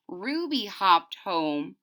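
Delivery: usual speaking voice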